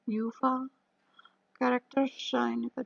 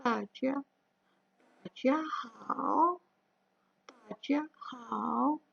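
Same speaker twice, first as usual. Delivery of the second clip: "tajia hao" spoken very slowly, each syllable drawn out; teacher modelling slowly; slow model phrase; much slower than usual. Shown as a demonstration. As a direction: spell out "tajia hao" slowly for students to copy.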